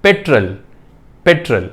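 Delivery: petrol with a short e, as पेट्रल; correct pronunciation. Shown as a demonstration. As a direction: petrol said slowly as a demo. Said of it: "So the correct pronunciation is".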